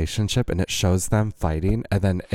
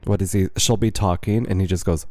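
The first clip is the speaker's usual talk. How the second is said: ordinary talk, nothing marked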